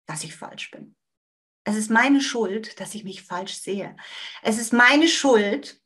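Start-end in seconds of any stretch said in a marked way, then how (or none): 0.1-1.0 softer, lower, barely voiced; whispered belief. Shown as a demonstration. Teacher voicing the inner belief, soft whisper. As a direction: whisper it like a secret shame.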